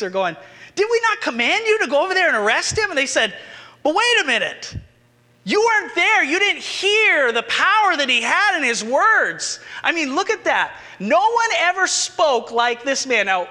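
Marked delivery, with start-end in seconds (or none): 0.7-2.9 high, loud, fast scolding demand; indignant scolding. Acted. Pharisees scolding their officers. 3.8-13.3 much higher and louder, eager insistence; excited protest. Acted. Officers excitedly defending Jesus.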